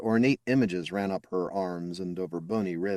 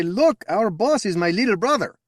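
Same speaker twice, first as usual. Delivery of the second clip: high, loud proud brag to his buddies; proud boast; 13 semitones higher, 7 dB louder than usual. Retold or imitated. Mechanic bragging to his deck buddies, high proud boast. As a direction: show off the little brother, beaming loud. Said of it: "grab my deck buddies and say".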